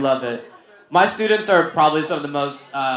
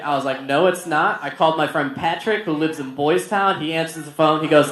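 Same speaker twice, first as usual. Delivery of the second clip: usual speaking voice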